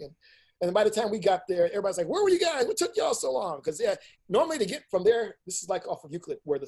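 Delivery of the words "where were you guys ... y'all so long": impatient questioning. Retold or imitated. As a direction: imitate a crowd demanding an explanation. Retold impatient questions on late arrival.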